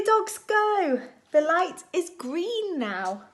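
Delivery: normal talking voice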